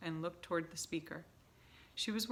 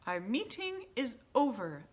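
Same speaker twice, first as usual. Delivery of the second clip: higher, louder commanding announcement; witch announcing; 6 semitones higher, 6 dB louder than usual. A narrator's reading. Grand High Witch ending the meeting, high and commanding. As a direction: declare it closed like a queen. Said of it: "announced the Grand High Witch".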